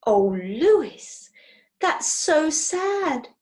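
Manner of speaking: slow, high sympathetic sigh; gushing sympathy. Acted. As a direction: melt with sympathy. Dora sympathises with the furrier.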